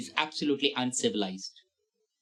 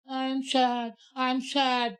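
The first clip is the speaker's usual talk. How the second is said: high, whiny fake sadness; mock someone fishing for pity; a high mocking pity-seeker; mock sad whine; 8 semitones higher, much slower than usual. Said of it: "like that".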